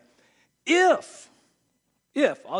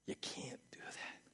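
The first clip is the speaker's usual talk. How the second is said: whisper; 18 dB quieter, slower than usual. Real, not acted. Stern aside to congregation.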